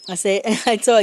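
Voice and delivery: ordinary talk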